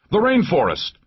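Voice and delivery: high shouted direction; alarmed, high-pitched cry; urgent call. A narrator's reading. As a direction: call out the escape route under fire. Calling out direction under fire.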